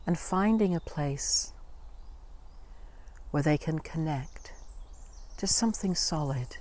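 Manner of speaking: calm, hushed phrases with space to breathe between them; guided meditation. Acted.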